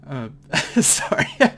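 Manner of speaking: teary laugh, apologetic; embarrassed laugh. Real, not acted. Preacher apologizing to congregation, laughing through tears. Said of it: "I was just getting so emotional".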